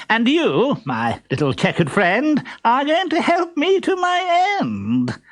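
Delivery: very high, pompous suitor voice; silly pompous voice. Acted. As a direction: play a vain fool, high and squeaky.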